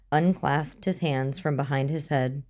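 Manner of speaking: ordinary delivery, nothing marked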